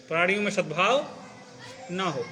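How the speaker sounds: long break after सद्भाव, then ना हो; सद्भाव rises, pause, ना हो falls; pause mid-phrase. Shown as a demonstration. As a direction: split off ना हो as its own phrase. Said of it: "रुक जाओ बीच में".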